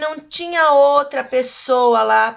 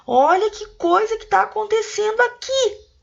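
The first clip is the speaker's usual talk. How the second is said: much higher, astonished voice; voice of amazement; 10 semitones higher than usual. Shown as a demonstration. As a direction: gasp it out in shock at what you see. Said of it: "com uma voz assim". (voz de espanto bem aguda)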